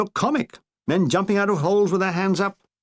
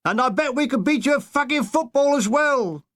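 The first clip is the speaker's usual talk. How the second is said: jeering shouts; 8 semitones higher than usual. Retold or imitated. Soldiers jeering German prisoners from foxholes, high mocking shout. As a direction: bawl insults at the passing prisoners. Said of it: "Here there were shouts of".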